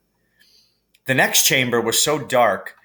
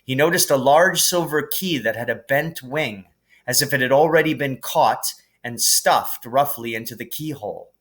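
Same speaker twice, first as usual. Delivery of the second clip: ordinary delivery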